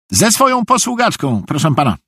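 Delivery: high, eager-to-please voice; high-pitched obedient fool; eager naive simpleton. A narrator's reading. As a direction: confess everything readily in a high voice. Narrator voices naive Szwejk.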